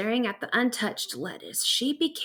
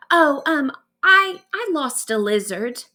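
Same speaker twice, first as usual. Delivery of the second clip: stammering, sheepish admission; embarrassed, halting confession; 5 semitones higher, 6 dB louder than usual. Acted. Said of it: "she admitted sheepishly".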